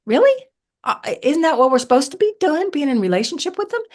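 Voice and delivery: high, quick baffled questioning; incredulous. Retold or imitated. Retold disbelief at book learning.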